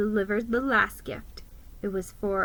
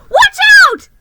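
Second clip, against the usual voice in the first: very high, loud panicked cry; alarmed squeak; 17 dB louder than usual. A narrator's reading. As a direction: warn him in sheer panic. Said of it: "I squeaked".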